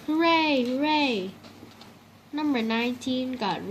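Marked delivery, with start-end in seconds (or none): 0.1-1.3 cheering. A narrator's reading. Kids cheer about penguins.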